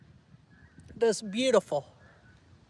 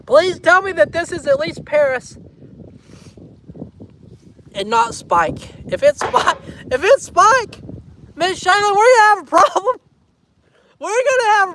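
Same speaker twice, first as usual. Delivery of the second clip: high, loud hammed-up protest; playful pleading threat, laughing between phrases; mock outrage; 7 semitones higher, 6 dB louder than usual. Mock protest over Spike on sign.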